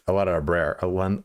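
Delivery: normal talking voice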